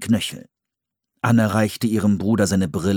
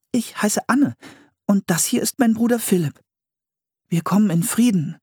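hohe Mädchenstimme, freundlich; Anne; 12 semitones higher than usual. A narrator's reading. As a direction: Anne stellt sich offen vor. Anne's voice in story. (hohe Kinderstimme, zutraulich)

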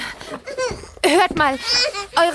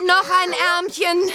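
slow coaxing; slower than usual. Acted. Dressing the second baby.

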